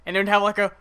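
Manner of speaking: ordinary talk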